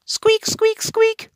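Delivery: very high, loud mouse squeaks; shrill little mice squeaking. Acted. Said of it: "went the mice".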